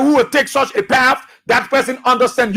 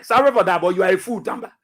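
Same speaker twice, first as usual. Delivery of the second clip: ordinary talk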